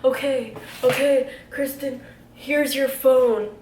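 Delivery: hammed-up tipsy placating; drunk guy. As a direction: play a drunk guy loudly giving in, slowing at the end. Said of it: "he's, like, so drunk".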